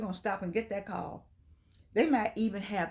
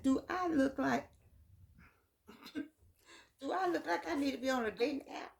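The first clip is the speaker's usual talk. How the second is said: higher, indignant "do I look like" retort; sassy comeback; 5 semitones higher, 4 dB quieter than usual. Retold or imitated. To a friend pushing a dating app, a sassy higher retort. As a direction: toss it back, offended and playful. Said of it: "why I said it like that".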